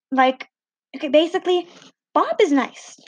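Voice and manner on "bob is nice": usual speaking voice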